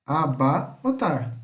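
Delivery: a - bar - rotar without a trill; Portuguese syllable split. Shown as a demonstration. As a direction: break Abarrotar up the Portuguese way, untrilled. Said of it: "Isso em português".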